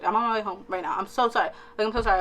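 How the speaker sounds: ordinary talk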